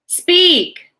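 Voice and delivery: very high, loud command; booming shout. A narrator's reading. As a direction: bark the order with full force. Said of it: "boomed the voice".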